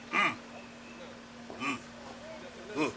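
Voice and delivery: short imitated "mm" grunts; wordless toddler grunt. Imitating Robert sitting in his chair, grunting. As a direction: grunt like a toddler who won't talk. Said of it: "he would just sit there and say".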